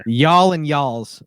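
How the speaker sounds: slow Southern "y'all and y'all's". Shown as a demonstration. Texan showing how his cousins talk, slow Southern. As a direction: stretch "y'all" the Texas way. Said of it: "you know".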